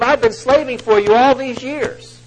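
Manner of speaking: high, loud and slow grievance; bitter complaint. Acted. Older brother complains to his father, loud bitter grievance. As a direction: lean hard into the resentment.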